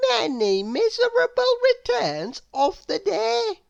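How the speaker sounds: put-on very high girl voice reading the card; cheerful squeak of a contrary wish; Little Miss Contrary. A narrator's reading. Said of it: "Inside it said".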